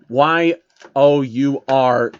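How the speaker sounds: slowly spelling Y O U R letter by letter; spelled out. Acted. Correcting the ponies, spelling Y O U R slowly. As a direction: spell out Y O U R deliberately. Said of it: "not you are, your".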